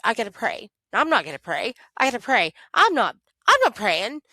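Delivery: venting anger at God, voice pushed up; mad at God. Retold or imitated. Grieving daughter telling God she's mad, heated and loud. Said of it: "Like, I'm mad".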